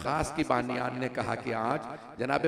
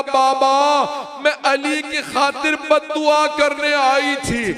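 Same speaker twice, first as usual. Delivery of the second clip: much higher, louder and slow; mourning lament; 12 semitones higher, 11 dB louder, slower than usual. Acted. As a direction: draw out the farewell with a wail. Fatima's final lament. (धीमी, ऊँची रोती हुई विदाई)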